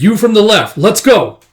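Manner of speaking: high, loud attack call; rally a partner into the attack; urgent order. Acted.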